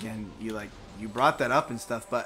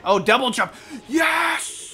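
excited shout; 12 semitones higher, 6 dB louder, much slower than usual. Real, not acted. Game success while playing.

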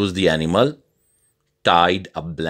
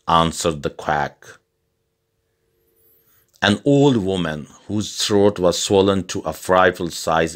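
usual speaking voice

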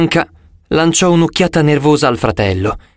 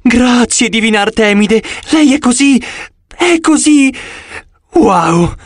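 babbling in awe; 9 semitones higher than usual. Acted. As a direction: gush at the goddess, starstruck and breathless. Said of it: "cominciò a blaterare". (balbettio entusiasta, acuto e forte)

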